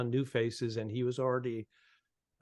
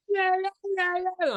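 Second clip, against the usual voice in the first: very high-pitched imitated kiddie squeals; squealing little girls. Comic retelling front row of girls running around, squealing. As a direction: mimic excited children shrieking "yeah".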